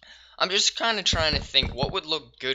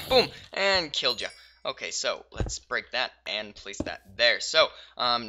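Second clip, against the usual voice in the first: usual speaking voice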